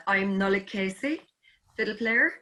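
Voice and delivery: usual speaking voice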